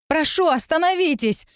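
high, pleading cry; calling out. A narrator's reading. Narrator appealing to the crowd, crying out. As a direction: cry out for everyone's attention, high. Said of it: "крикнула я".

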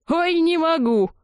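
high, slow words through laughter; mock them while cracking up; laughing fit. A narrator's reading. The other heads laugh at the offended singer, laughing. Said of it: "зашлись хохотом его собеседники".

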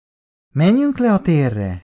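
put-on high, slow child's request; pleading little boy. A narrator's reading. Son asks his father to go play, high pleading child. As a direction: ask high and drawn out, like a small boy.

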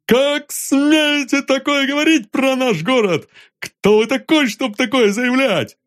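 high outraged yelling; indignant shout. Acted. Arkasha accusing Vanzarov in public.

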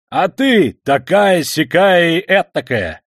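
slow, loud furious shout; shouting at full voice. A narrator's reading. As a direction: bellow it out. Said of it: "крикнул он вдруг во всё горло".

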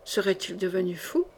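low, quiet inner question; private thought. A narrator's reading. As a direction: wonder softly to yourself. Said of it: "Songea Gringoneur".

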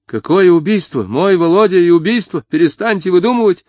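high, loud protest; indignant disbelief. A narrator's reading. Mother denying her son could kill, indignant. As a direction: reject it in shrill outrage. Mother rejects the murder charge.

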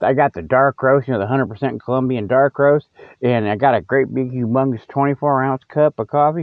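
ordinary talk

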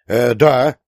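loud, eager outburst; exclaiming. A narrator's reading. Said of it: "воскликнул Васёнок". (громкое восторженное восклицание)

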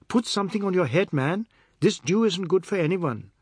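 high, fast bossy call; aunt calling out to a man; fussing call. A narrator's reading. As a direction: call out caring orders.

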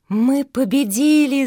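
high, loud cry of victory; jubilant shout. A narrator's reading. Fenya celebrates victory with her sister, shouting joyfully. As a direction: shout it out, elated. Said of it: "закричала Феня".

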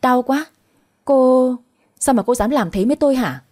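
acted outraged yelp; pained yelling. A narrator's reading. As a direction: yell it in pain, in a contained narrator's way. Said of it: "hét ầm ĩ cả lên".

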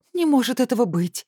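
high, tearful exclamation; almost crying out in disbelief; near tears. A narrator's reading. Plump woman cries out almost in tears. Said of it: "чуть не плача воскликнула".

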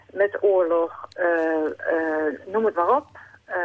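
ordinary talk, nothing marked

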